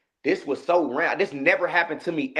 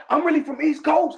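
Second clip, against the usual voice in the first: mock yell; 8 semitones higher than usual. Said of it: "starts screaming and hollering".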